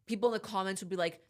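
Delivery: ordinary talk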